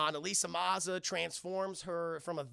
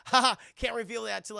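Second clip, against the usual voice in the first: loud, high teasing cackle; acted impish gloating; playful trickster; 5 semitones higher, 8 dB louder, faster than usual. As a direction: tease it with a loud, pitched-up laugh. Puck teasing with a secret.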